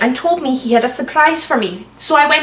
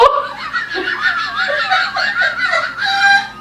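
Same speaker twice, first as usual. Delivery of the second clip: high shrieking laugh. Real, not acted. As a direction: let out a shrill cackle of laughter. Laughs at the story.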